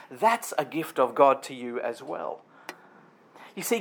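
ordinary delivery

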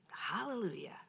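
whispered thanks. Real, not acted. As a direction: breathe it out as a private prayer. Whispered praise.

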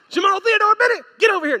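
loud, high mock-scolding mom; imitated angry mother's shout; mother's full-name summons. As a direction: holler it like a mother demanding attention. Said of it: "let him hear her say".

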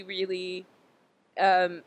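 ordinary delivery

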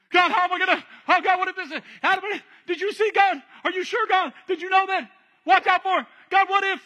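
high, loud, frantic stammering; anxious panic. Acted. Worried believer pestering God, frantic and panicked. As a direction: babble every worry at God. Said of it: "We live life that same way".

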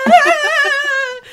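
loud high scream of fright; startled scream. Retold or imitated. Recalling the foot grab to roommates, loud scream. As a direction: shriek like something grabbed you. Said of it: "I'm like".

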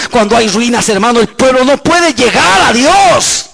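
high-pitched, impassioned pulpit shout; full-force preaching. Acted. Preacher rousing his congregation to shake the city, full-force preaching. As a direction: preach it out, stretching the big words.

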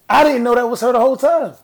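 high, loud exclamation of innocence; loud protest. Real, not acted. Podcaster defending herself over a DM, loud high protest. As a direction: blurt it out high and loud in your own defense.

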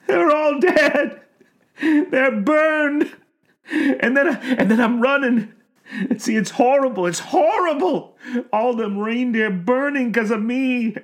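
high breaking sobs; sobbing old man. A narrator's reading. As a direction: weep out the confession. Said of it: "the sobbing started up again".

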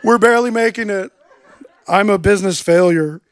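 mock wallowing said through a laugh; laughing mock confession. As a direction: play the failure for laughs. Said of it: "don't wanna go to this meeting and be like".

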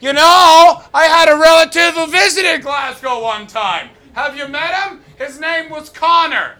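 very high, silly put-on voice; mock North American tourist. Comic mocking North Americans visiting Glasgow, high-pitched tourist voice. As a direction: squeal it like a clueless visitor claiming local ties. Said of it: "one of those North Americans that come over".

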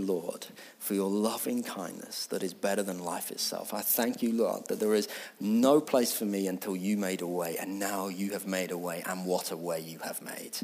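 soft devotional prayer voice; hushed prayer. Acted. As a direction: pray quietly, drawing them in. Quiet closing prayer.

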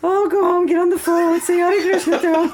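very high, loud mock Indian accent; bad Indian accent. Temple lady sending the daughter home to sing, bad Indian accent. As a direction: imitate an insistent Indian lady with a poor, lilting accent. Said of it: "I'm sorry, it's a bad accent".

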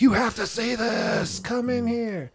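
high, slow excited call to the next room. Retold or imitated. Calling his friend from the other room to the TV, excitedly. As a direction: shout them in, thrilled.

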